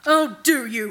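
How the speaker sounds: snarled. A narrator's reading. Araneth answers new commands from his master, snarling. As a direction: throw it back as a bitter challenge. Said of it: "Araneth snarled".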